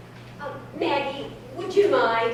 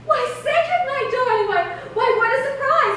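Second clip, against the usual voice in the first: higher, gushing theatrical delight; 7 semitones higher than usual. Acted. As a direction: coo over him like a star. Gushing over a suitor's call.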